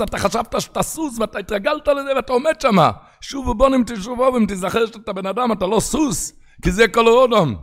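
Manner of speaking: rising sermon fervor; preaching at full force. Acted. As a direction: rouse the listeners toward repentance.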